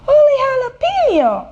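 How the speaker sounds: high-pitched burst of amazement; excited exclamation. A narrator's reading. Kitten finding a bird in his ear, exclaiming. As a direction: exclaim it high, held, then dropping. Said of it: "exclaimed Skippy John Jones".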